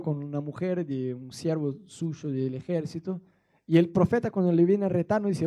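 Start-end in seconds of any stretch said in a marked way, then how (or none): none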